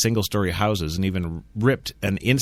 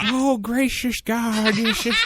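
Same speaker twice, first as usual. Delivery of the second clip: hammed-up Texas preacher, high and slow; Texas preacher accent; 11 semitones higher, slower than usual. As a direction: drawl it like a bad Texas preacher. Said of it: "I don't have my Texas accent down".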